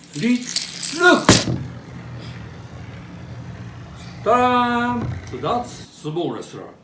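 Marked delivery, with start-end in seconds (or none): 0.1-5.0 high, loud showman's ta-da; triumphant ta-da. Real, not acted. Top does its acrobat trick, triumphant loud ta-da. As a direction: present the trick with a flourish.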